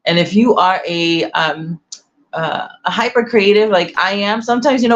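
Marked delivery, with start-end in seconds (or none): none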